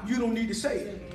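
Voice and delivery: normal talking voice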